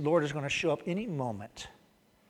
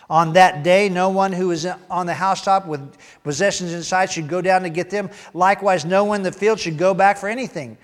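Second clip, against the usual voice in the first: loud, raised reading of Jesus' words; preacher reading scripture aloud, loud and raised; scripture reading at full force; 5 semitones higher, 12 dB louder than usual. Acted. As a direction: proclaim the passage to the congregation.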